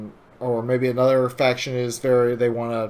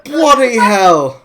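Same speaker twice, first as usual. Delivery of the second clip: much higher, louder, slow put-on British shout; mock British exclamation; 8 semitones higher, 10 dB louder, slower than usual. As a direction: bark it like a cartoon Brit. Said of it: "impression of my accent".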